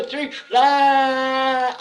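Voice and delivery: loud energetic shout of rah; roaring rah. Shown as a demonstration. Coach roars to energize the class, loud shout. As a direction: let out a big rah on three. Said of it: "make a sound to put some energy into it".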